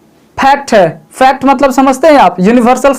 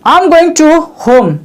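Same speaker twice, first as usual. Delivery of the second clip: very slow, word by word; slow wrong example; 3 semitones higher, much slower than usual. Shown as a demonstration. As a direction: lay out the wrong sentence slowly. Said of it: "Third one that". (धीरे-धीरे बोला गया गलत वाक्य)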